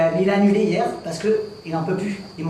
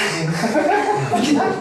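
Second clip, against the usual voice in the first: big laugh; 7 semitones higher than usual. Real, not acted. Comic laughs at own joke.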